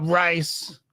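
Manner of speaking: usual speaking voice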